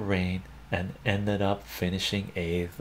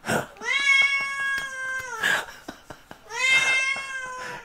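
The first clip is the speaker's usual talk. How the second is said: long helpless high laugh; high wheezing laugh. Real, not acted. Cracking up at own cat translation joke, high squeal.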